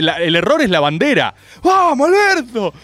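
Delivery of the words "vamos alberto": high, slow mock crowd chant; arenga imitada. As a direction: cheer like a fervent supporter, hammed-up. Imita al público que alienta. (aliento de hinchada, exagerado)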